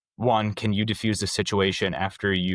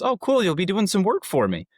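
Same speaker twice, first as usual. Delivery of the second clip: much higher, breezy and upbeat; cheery boss; 11 semitones higher than usual. Retold or imitated. Jeff telling his new hire he'll work for him, breezy and high. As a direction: toss it off with easy cheer. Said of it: "he goes".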